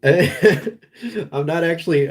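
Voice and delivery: words squeezed out through real laughter, slow; can't stop laughing at the meme; giggling helplessly while trying to speak. Real, not acted. Cracking up mid-sentence.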